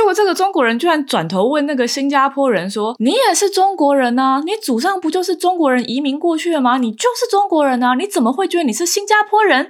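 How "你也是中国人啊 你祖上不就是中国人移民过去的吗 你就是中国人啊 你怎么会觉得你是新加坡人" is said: higher, pressing insistence, lightly voiced; pushy insistence. Dinner guest pressing a Singaporean colleague, mock-pushy and higher. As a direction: lightly imitate a man badgering with rhetorical questions. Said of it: "很咄咄逼人的类型".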